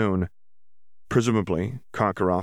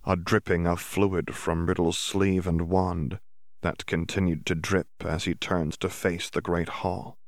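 ordinary delivery

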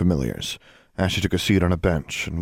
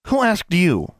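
high, curt retort; sharp snap; 11 semitones higher, 3 dB louder, slower than usual. Acted. Alexandra rebuffs the staring old woman, sharp snap. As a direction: bite back at the hag. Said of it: "Alexandra snapped".

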